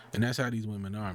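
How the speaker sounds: ordinary talk, nothing marked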